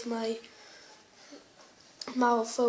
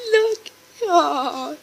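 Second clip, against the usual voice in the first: high-pitched coo; 5 semitones higher, 10 dB louder than usual. Real, not acted. Cooing at the dog.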